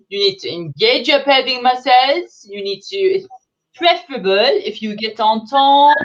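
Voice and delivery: put-on thick comic French accent, breezy and blunt; a French diet guru lecturing British mothers in a heavy French accent; Fifi Lafolle French accent. French guru advising new mothers, heavy French accent. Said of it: "So my name is Fifi Lafolle".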